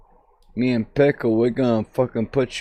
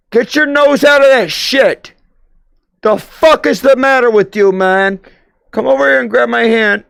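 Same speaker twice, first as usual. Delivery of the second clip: scolding shout; 9 semitones higher, 6 dB louder than usual. Pretend. Scolding someone in game.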